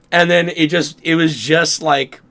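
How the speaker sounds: usual speaking voice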